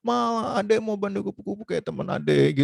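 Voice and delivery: high, imitated little-kid whine; pleading child. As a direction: beg mum like a small child. Child begging mother after school.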